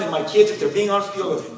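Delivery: ordinary talk, nothing marked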